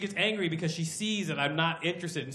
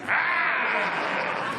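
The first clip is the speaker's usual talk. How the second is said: mock roar; 8 semitones higher, 4 dB louder than usual. Bodybuilder roars lifting.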